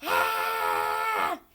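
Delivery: deep bellowing cry of pain; low yell. A narrator's reading. Father bitten on the nose, deep bellow. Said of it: "He yelled".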